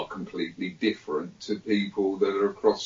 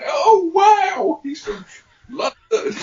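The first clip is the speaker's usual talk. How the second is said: very high, loud mock squeal of amazement; hammed-up awestruck shriek; gushing American girls; 10 dB louder, slower than usual. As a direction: imitate starstruck teenage girls, loudly and high-pitched. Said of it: "with all the girls".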